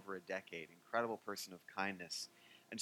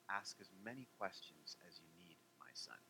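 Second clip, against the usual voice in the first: much softer, lower and tender; gentle rabbi; 5 semitones lower, 14 dB quieter than usual. Retold or imitated. Hillel sits beside the man, soft and tender. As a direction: settle him with deep patience. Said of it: "he had such a quiet way".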